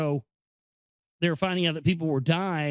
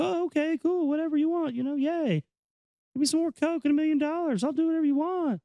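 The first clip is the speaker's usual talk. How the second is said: much higher, airheaded mock pop star voice; mocking ditzy pop star; 11 semitones higher than usual. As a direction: parody a spoiled singer agreeing to anything. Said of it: "And they say".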